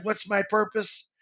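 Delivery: normal talking voice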